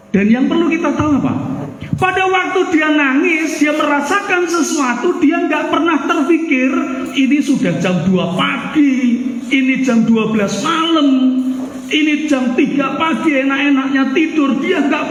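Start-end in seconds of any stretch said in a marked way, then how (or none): none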